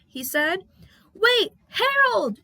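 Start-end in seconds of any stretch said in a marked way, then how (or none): 1.1-2.4 high, loud, slow shout. Acted. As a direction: call after him urgently. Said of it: "shouted Percy".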